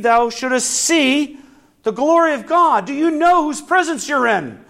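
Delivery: preacher stirring up the flock; fervent preaching. Acted. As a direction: drive the point home to the congregation. Preaching at full force.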